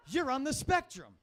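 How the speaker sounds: hammed-up viral video voice; mocking TikTok. Comic mocks a TikTok video, loud and high. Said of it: "TikTok video that said".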